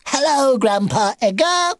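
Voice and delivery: very high, squeaky little animal voice; baby beaver, delighted. Acted. Baby beaver greeting his grandpa, squeaky and delighted. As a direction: pipe up happily, pitched right up.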